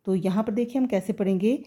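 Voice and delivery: usual speaking voice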